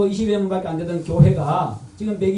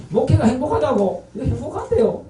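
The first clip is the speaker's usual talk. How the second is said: higher-pitched, imitated incredulous exchange; mock astonishment, voice raised high; retold disbelief; 6 semitones higher than usual. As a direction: echo a baffled colleague, pitch lifted. Retells colleagues' disbelief and reply.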